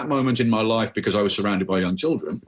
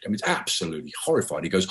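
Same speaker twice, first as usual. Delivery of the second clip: ordinary talk, nothing marked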